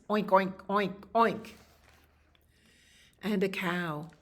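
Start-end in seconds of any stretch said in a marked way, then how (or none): 0.1-1.5 quick run of loud oinks; pig oinking. Acted. Reader voices more pigs arriving, loud oinking. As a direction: oink like a pen of pigs.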